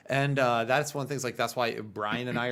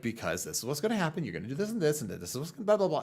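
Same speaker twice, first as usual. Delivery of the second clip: fast, higher, rattled-off explanation; imitated rant; 6 semitones higher, faster than usual. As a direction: imitate a friend reeling off a lecture. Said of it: "goes on a whole rant".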